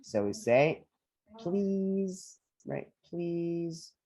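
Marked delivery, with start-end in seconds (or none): 1.4-2.4 "Please" high and drawn out, buzzing final s; "Please" overdone as a high "pleeeze"; exaggerated pronunciation. Shown as a demonstration. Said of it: "kind of exaggerating it". 3.0-3.9 "Please" raised, softer, held on "ee"; "Please" drawn out high to a z; exaggerated pronunciation. Shown as a demonstration. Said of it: "kind of exaggerating it".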